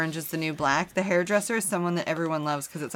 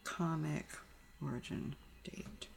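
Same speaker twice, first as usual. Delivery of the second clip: very soft, trailing almost into a whisper; muttering to oneself; 17 dB quieter, slower than usual. Real, not acted. Co-host looking up the comic's origin date, muttering to oneself. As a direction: murmur it under your breath while typing. Typing a web search aloud.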